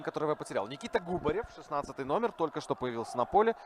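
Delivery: normal talking voice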